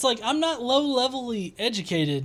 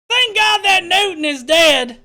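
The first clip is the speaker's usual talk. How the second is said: loud, high mocking shout; mock shouted quote; 8 semitones higher, 8 dB louder than usual. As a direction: yell it like an outraged commenter. Reading a comment mockingly.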